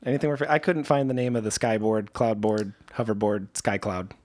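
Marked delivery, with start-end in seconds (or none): none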